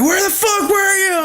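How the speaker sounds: mock girlfriend scolding in a high voice; imitating angry girlfriend. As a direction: ham up her shrill demand.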